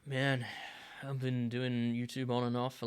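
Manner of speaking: usual speaking voice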